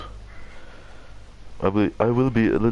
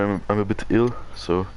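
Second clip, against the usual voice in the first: usual speaking voice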